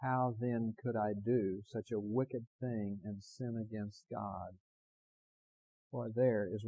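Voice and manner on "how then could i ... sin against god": slow recitation. Shown as a demonstration. Preacher leading the congregation, slowly reciting together. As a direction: say it slowly with me, word by word. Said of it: "Would you say that last sentence with me?".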